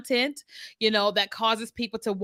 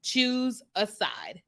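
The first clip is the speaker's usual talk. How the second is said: drawn out slowly and softer; slow emphatic; 4 dB quieter, much slower than usual. Shown as a demonstration. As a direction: spell it out word by word, letting each one land. Stating the one message to tell them.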